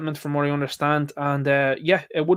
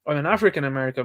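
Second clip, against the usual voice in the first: ordinary delivery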